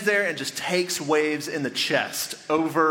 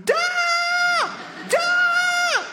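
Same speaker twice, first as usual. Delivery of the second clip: put-on high, loud little-boy yell at each wave; son's shout against the waves, high and loud; kid's battle shout; 3 dB louder than usual. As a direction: bark it out like a small boy bracing against a wave. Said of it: "I would stand as the waves went and go".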